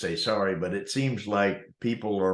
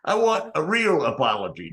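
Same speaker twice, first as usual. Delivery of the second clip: raised, higher, louder demand; indignant demand; 7 semitones higher, 5 dB louder than usual. Retold or imitated. Customer demanding a proper apology, loud and indignant. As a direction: push hard for what he's owed. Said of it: "and he's like".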